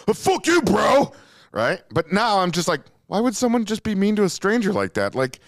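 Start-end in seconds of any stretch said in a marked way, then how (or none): 0.1-1.1 high, loud mock comeback yell; mock angry yell. Recalling how his younger self would snap back at a rude stranger, mock angry yell. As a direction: bark it back like a hothead. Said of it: "in my younger years, I instantly would have been like".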